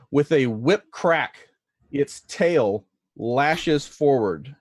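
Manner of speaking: slow, drawn-out dramatic scene setting; game master narration. Acted. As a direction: narrate the attack deliberately, stretching the key words.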